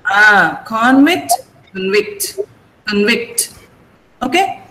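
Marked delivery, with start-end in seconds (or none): 0.7-1.3 convict as CON-vict; first-syllable stress. Shown as a demonstration. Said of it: "Convict is". 1.7-2.4 convict as cun-VICT; weak first vowel, stressed VICT in convict; second-syllable stress. Shown as a demonstration. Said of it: "Convict is an". 2.9-3.5 convict as cun-VICT; second-syllable stress. Shown as a demonstration. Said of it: "Convict is an".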